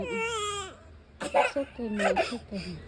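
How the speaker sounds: slow, soft comforting murmur; slow soothing. Real, not acted. Soothing a crying child. (dahan-dahang pag-aalo)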